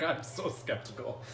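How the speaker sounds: ordinary delivery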